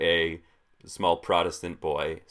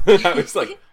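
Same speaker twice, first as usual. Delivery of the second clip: laughing; 13 semitones higher, 16 dB louder than usual. Real, not acted. Laughing disbelief at understanding little.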